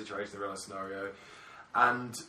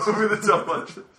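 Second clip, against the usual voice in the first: laughing; 7 semitones higher, 9 dB louder than usual. Real, not acted. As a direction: repeat it through a laugh, higher and louder. Repeated, laughing and louder.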